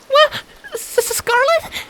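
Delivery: very high, stammering squeak; stunned and stuttering; squeaking filly. A narrator's reading. Primrose seeing Scarlet appear, high stammering squeak. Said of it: "Primrose squeaked".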